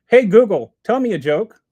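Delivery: loud call to the phone assistant. Real, not acted. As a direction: call out loudly so the phone picks it up.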